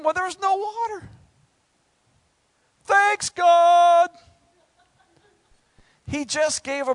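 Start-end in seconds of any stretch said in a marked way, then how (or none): 2.8-4.1 loud, high mock gratitude; sarcastic thanks. Preacher sarcastically thanking God, loud and high.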